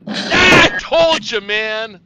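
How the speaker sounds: loud, high gloating yell; triumphant shout. Real, not acted. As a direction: crow over the win at full volume. Gloating after winning roll.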